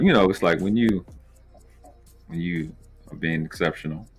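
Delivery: ordinary delivery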